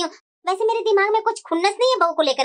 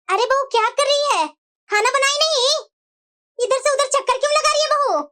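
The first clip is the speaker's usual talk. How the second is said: calling out sharply; 6 semitones higher than usual. Acted. Mother-in-law calls out to daughter-in-law.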